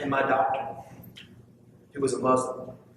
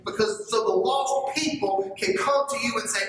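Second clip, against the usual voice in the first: fervent, higher-pitched preaching; 8 semitones higher than usual. Acted. Preacher driving home his point to the church. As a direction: preach it out to the congregation.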